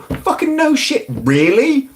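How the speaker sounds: high, drawn-out mock surprise; sarcastic disbelief. Real, not acted. Streamer reacting to belated news about actor, sarcastic. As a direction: stretch it out high with heavy sarcasm.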